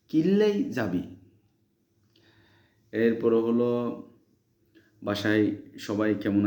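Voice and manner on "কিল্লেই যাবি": high dialect repeat. Shown as a demonstration. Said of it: "এটাকে আমরা বলি যে".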